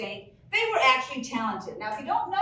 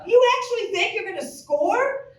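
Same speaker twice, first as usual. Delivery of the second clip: mocking disbelief; 9 semitones higher, 5 dB louder than usual. Mocking Larry.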